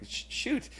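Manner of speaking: a hushed whisper, stunned; stunned kids whispering their shock. Retold or imitated. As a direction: breathe it out in disbelief.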